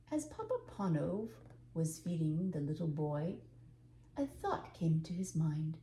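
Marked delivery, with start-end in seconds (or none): none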